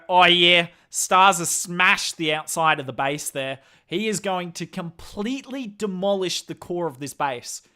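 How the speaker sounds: lightly hammed-up Australian caster voice, loud start; a playful put-on Australian accent; mock Aussie commentator. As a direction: play up a broad Australian accent as a joke. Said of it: "do an Aussie accent all day".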